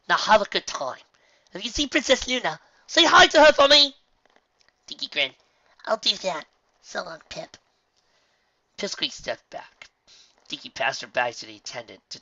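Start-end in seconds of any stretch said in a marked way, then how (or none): 0.1-3.9 high, loud, eager colt; enthusiastic. A narrator's reading. 5.8-7.6 put-on young filly voice. A narrator's reading.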